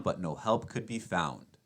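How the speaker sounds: plain reading voice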